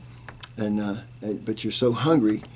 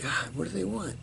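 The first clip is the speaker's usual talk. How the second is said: higher, softer exhausted grumble; weary groan; 9 semitones higher, 9 dB quieter than usual. Retold or imitated. Exhausted Ranger grumbling at being summoned again, high and soft. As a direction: sigh it out, worn out.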